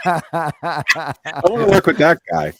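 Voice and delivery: laughing. Acted. Mocking the author, laughing.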